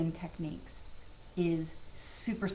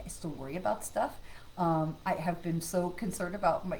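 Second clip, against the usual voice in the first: ordinary delivery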